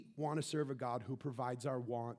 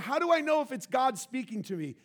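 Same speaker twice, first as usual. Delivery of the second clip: anxious believer; 11 semitones higher, 11 dB louder than usual. Retold or imitated. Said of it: "I hear so many people".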